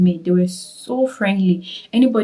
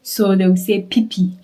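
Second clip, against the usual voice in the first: ordinary talk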